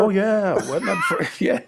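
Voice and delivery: laughing it out; amused laugh. Real, not acted. Laughing about buying his picture.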